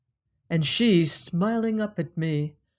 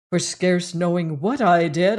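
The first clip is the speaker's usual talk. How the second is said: plain reading voice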